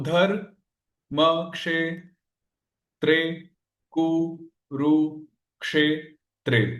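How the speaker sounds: each syllable of धर म क्षे त्रे कु रु क्षे त्रे held and set apart. Shown as a demonstration. Teacher splitting the shloka to count its syllables, syllable by syllable. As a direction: break the verse into separate, slow syllables. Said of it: "कितने हो गए?".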